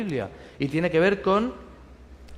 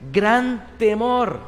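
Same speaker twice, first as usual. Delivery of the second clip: "gran temor" slowed right down and raised; emphatic; 5 semitones higher, 4 dB louder, much slower than usual. Shown as a demonstration. Preacher stressing the shepherds' fear, slowly. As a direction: hold "gran temor" long and high.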